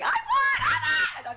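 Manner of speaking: high, loud victory shriek; retold screaming. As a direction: shriek it down the stairs like you just won.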